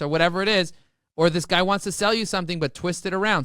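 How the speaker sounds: usual speaking voice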